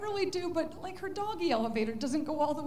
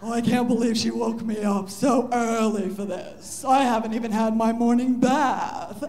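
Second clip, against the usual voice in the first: grumpy cat; 4 semitones lower, 8 dB louder than usual. Pretend. Cat grumbles during the race.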